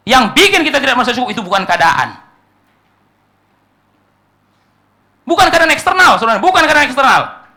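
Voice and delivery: fervent preaching. Acted. Preacher to congregation denying circumstances matter, fervent. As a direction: hammer the point home with a cry of no.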